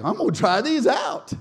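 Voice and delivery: high, loud, cocky announcement; eager boast. Acted. Peter with brand new keys, loudly eager. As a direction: crow it at full voice.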